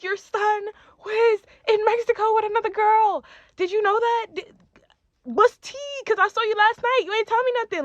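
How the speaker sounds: loud, high-pitched confrontation; indignant yelling. Retold or imitated. Girlfriend telling his mom about Mexico, loud indignant outburst.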